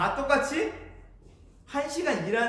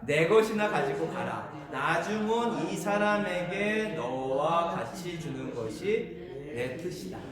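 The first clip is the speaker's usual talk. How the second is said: slow, drawn-out group Bible reading; low, measured scripture recitation; scripture read-aloud; 4 semitones lower, slower than usual. Real, not acted. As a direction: lead the verse slowly, almost intoned. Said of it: "같이, 같이 보겠습니다".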